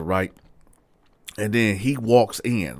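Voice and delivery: ordinary delivery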